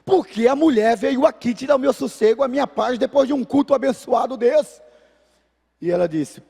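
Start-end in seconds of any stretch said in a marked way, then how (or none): 0.1-4.8 retold yelling. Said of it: "Por que que você tá gritando?".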